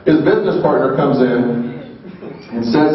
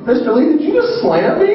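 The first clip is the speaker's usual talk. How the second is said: stunned disbelief; 13 semitones higher than usual. Retold or imitated. Asking Stan Lee if he really slammed him, stunned and high.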